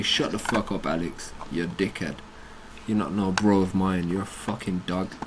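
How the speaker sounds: soft, low muttered abuse; contemptuous aside; muttered insults. Real, not acted. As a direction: grumble insults under your breath. Insulting Alex under breath.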